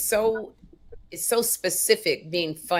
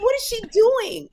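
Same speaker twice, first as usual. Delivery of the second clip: incredulous. Retold or imitated. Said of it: "I'm going".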